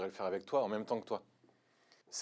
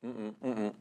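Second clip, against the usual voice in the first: mouth full. Real, not acted. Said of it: "Pas pouvoir parler avec la bouche pleine".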